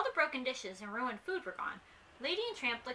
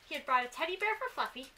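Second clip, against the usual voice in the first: ordinary delivery, nothing marked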